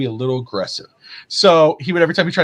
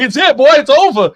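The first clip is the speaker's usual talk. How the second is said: loud, high triumphant taunt; retold gloating; 14 semitones higher, 10 dB louder than usual. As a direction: rub in the win, loudly. Dad gloating over his son, retold.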